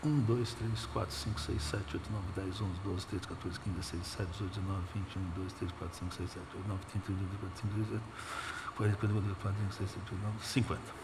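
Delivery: soft, hurried counting under the breath; rapid muttered counting. Shown as a demonstration. Preacher demonstrating counting the crowd, hushed rapid count. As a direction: rattle off the numbers quietly. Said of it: "Quinze mil pessoas?".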